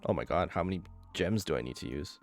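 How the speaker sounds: ordinary delivery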